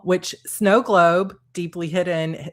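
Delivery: ordinary talk, nothing marked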